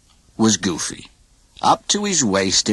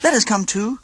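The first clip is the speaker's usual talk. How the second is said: begging nephews; 12 semitones higher than usual. A narrator's reading. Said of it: "his nephews begged".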